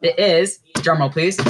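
ordinary talk

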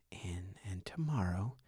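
hushed aside; breathy whispered lead-in. Retold or imitated. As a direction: whisper it conspiratorially. Whispered to daughter.

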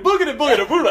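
high, loud boo-style gibberish; playful scare. Retold or imitated. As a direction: jump out and spook them. Jumps up to scare driver.

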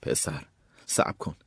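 low, slow, hushed self-talk; slow yourself down under your breath; muttered pep talk, held back; talking to himself. A narrator's reading. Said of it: "به خودش گفت".

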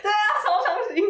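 high loud laughing agreement. Real, not acted. Agreeing with the host, laughing loudly.

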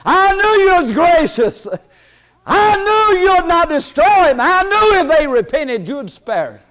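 high, loud indignant complaint; angry Jonah. Acted. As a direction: rail at God like a sulking prophet. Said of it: "And Jonah said".

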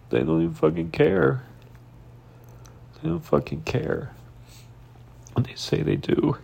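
high, breaking, crying voice; real tearful upset. Real, not acted. Confiding heartbreak over someone uncaring, tearful and upset. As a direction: pour out heartbreak through tears. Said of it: "I'm a very emotional person".

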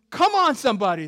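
loud, high preacher's shout; rousing call. Real, not acted. Preacher demanding a response from congregation, shouting.

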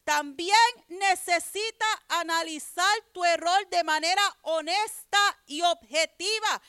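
ordinary talk, nothing marked

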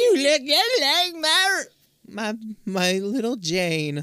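put-on high, slow, quavering old woman; old lady voice. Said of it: "old lady who's like".

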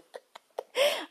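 very high giggle after a joke; real amused squeal; high squeal of a laugh. Real, not acted. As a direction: laugh at your own joke.

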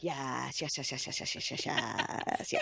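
soft hushed string of yes; breathy mock yes. Mocking the date's excited yeses, hushed and breathy. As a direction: whisper the yeses like a creep. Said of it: "And he's like".

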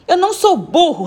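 put-on high, indignant little-boy comeback; indignant retort. A narrator's reading. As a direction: snap back, offended. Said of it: "retrucou ele". (resposta indignada de menino)

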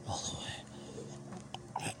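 very soft, slow whispered reply. Acted. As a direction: drag it out in a hush. Question then whispered repeat.

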